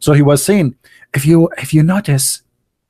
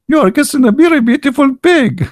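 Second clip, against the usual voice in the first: high, teasing jab; mocking punchline; 10 semitones higher than usual. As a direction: needle him with a mock-sweet line.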